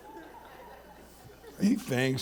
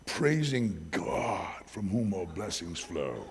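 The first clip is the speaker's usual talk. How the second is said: hymn-like; 4 semitones lower, slower than usual. Retold or imitated. Mock pious grace.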